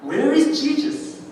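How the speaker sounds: very slow, high "Where is Jesus?"; crying out. Acted. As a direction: call out the question, drawn out. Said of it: "한 제자가 외칩니다".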